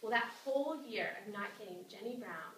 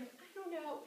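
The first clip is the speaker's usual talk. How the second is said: high, noncommittal shrug of a line; lightly voiced doubtful girl; unsure reply; 9 semitones higher than usual. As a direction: let it hang, reluctant. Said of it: "she's like".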